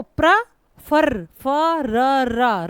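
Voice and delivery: प्र, फर broken into separate loud pieces; sounded-out syllables. Shown as a demonstration. Teacher showing the doubled R in फर, sounded out piece by piece. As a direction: sound out फर as फ, र, र with the R repeated. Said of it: "रा दो बार हो गया".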